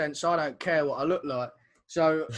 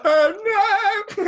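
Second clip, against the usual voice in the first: high loud horrified cry; shocked gasp; 13 semitones higher, 7 dB louder than usual. Retold or imitated. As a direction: sound aghast. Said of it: "She was like".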